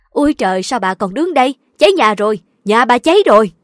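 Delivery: loud urgent alarm, building; panicked warning. A narrator's reading. Neighbour shouts that the house burns. (hốt hoảng báo tin lớn tiếng)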